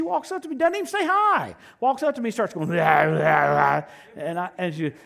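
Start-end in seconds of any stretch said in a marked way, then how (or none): 2.7-3.8 slow, much louder ranting gibberish; hammed-up possessed woman babbling at him. Retelling a possessed woman confronting him, slow loud gibberish. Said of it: "act like she's possessed with the devil".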